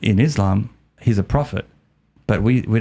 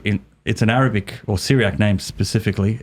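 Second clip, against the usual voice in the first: ordinary delivery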